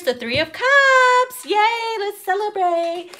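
high, bright cheering; excited. Real, not acted. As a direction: celebrate the card, high and loud. Cheering a celebration card.

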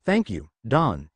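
ordinary delivery, nothing marked

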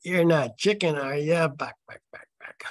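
hammed-up taunt that fades away on the clucks; chicken taunt, acted out. Reader acts out Mike goading Jim, taunting and hammy. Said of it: "Well, let's do that".

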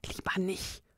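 soft breathy whisper. A narrator's reading. Sylvania whispering back to her sister, hushed. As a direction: whisper it so the ghost won't hear. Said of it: "flüsterte Sylvania zurück".

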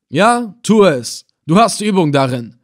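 villain urging his man on; commanding approval. Acted. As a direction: give the order forcefully. Villain loudly approves the volunteer torturer.

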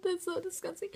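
laughing while grossed out; laughing disgust. Real, not acted. Laughing after gross bean.

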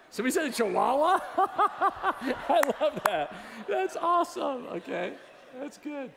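high, loud laughing delight. Real, not acted. Preacher laughing at the congregation's Chihuahua answer, high and loud. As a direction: crack up at the answer.

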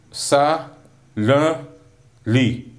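"Sa, l, li" as three separate beats; split into syllables. Shown as a demonstration. Teacher sounding out silently for students, "Sa, l, li" one by one. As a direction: sound out "Sa, l, li" with a pause after each. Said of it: "Three syllables".

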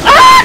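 very high, loud scream; startled scream. Real, not acted. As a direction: scream at a sudden scare. Susto en el juego. (grito agudo de susto)

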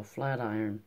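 a low, mumbled growl of one word. A narrator's reading. Gruff stoker muttering his trade to Miss Tox, low growl. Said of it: "understood to growl".

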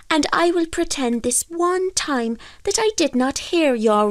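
high-pitched, drawn-out fairy voice; condescending fairy. Acted. Fairy offers fisherman salmon for a favour, condescending. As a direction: bargain in a high little-man voice.

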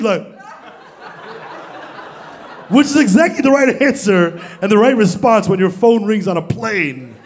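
ordinary talk, nothing marked

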